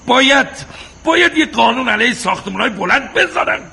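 indignant rant. Acted. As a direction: hammer it out in outrage. Husband rants about apartment buildings.

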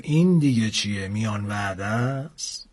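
a hungry beast sizing up a snack; dinosaur voice. A narrator's reading. Crocodile-like dinosaur to the narrator, slow and low.

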